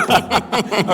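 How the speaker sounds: high laugh. Real, not acted. Laughs at audience greetings.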